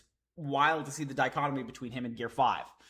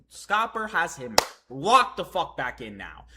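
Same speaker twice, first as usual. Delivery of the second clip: ordinary talk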